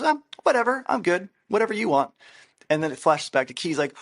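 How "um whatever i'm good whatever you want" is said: much higher, airy and carefree; chill reply. Retold or imitated. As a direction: toss it off easy, mock-laid-back. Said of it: "so he replies back, goes".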